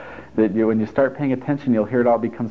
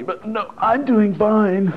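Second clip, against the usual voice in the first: high, strained, drawn-out insistence; brave front; 11 semitones higher, much slower than usual. Acted. Insisting all is well under a big weight, strained high. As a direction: squeeze out a high, slow mock reassurance.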